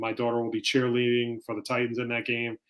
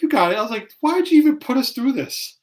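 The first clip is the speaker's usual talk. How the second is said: much higher, loud mock outrage; incredulous outburst; 11 semitones higher, 5 dB louder than usual. Cheer dad venting at the judges, high loud outrage. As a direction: throw up your hands, pitched way up and loud.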